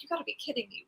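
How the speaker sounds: soft disbelieving mutter; quietly exasperated over lost keys; muttered frustration. Retold or imitated. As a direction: say it under your breath, fed up. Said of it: "I was frustrated 'cause I was like".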